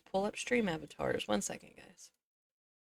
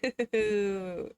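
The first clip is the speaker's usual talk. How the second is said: delighted giggle. Real, not acted. Giggle after the gushing.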